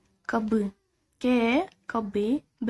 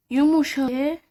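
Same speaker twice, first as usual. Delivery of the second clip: يمشى said high and loud as a key term; stressed yumuşak; 4 semitones higher, 6 dB louder, much slower than usual. Shown as a demonstration. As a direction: call out the word يمشى. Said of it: "يعني ج خفيفة". (نطق يمشى بتشديد وعلو)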